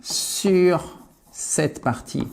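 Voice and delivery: normal talking voice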